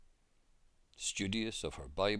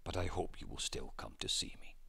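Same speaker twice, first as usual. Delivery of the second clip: calm whisper. A narrator's reading. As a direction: let Mary answer in a hushed, calm whisper. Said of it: "she said calmly".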